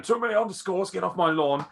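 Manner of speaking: put-on high, loud cranky old man voice; grumpy old man. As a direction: rant like a cranky old geezer. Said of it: "that'll sound like an old man".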